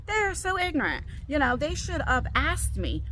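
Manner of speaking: high-pitched, aggrieved complaint; exasperated inner thought. Retold or imitated. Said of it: "I'm thinking to myself".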